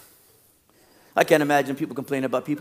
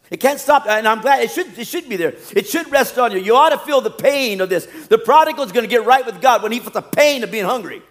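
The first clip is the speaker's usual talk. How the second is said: higher, louder, insistent sermon delivery; forceful preaching; 7 semitones higher, 5 dB louder than usual. Real, not acted. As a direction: drive the point home like an impassioned preacher.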